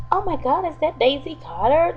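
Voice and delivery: high-pitched mock astonishment; feigned surprise. Woman pretends not to know the phone, high feigned surprise. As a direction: act as if you had no idea. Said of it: "like, she didn't know who it was".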